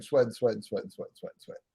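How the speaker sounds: trailing off into nothing; fading away. Acted. Repeated sweating fading away.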